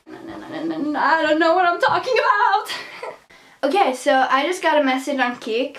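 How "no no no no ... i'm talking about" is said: laughing through the words. Real, not acted. Vlogger laughing at herself for misnumbering tips. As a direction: giggle at her own mistake.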